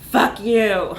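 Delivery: loud snapped curse; angry retort. Acted. Astronaut answers the recruiter, loud angry retort. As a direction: bite it off in anger.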